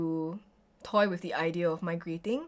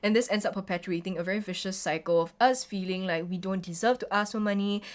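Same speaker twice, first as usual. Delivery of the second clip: ordinary delivery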